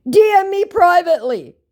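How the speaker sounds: high, loud mock command; hammed-up, declaimed greeting; scammer voice. Scammer opens with a demand, high loud put-on voice. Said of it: "read this exactly as it's written".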